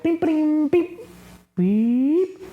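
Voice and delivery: high chirpy mock radar beeps; scanner beeping noise. Cat peeking out of the box, scanning the room: high mock radar beeps. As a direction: beep like a little periscope sweeping the room. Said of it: "like she's scanning the area".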